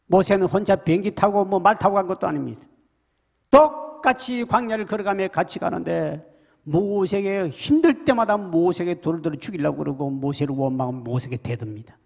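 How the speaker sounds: normal talking voice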